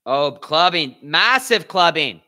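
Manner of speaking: loud, slow excited call. Real, not acted. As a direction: belt it out like a ringside fan. Reacting to a big slap.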